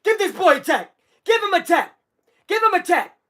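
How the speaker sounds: mock yelling at a ref; high-pitched demand in short repeated bursts; Draymond. Streamer yelling as the player at the ref, mock outrage. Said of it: "Draymond's gonna yell".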